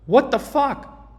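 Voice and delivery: high, slow exasperated cry. Real, not acted. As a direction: throw up your hands in disbelief. Disbelief at lost trade.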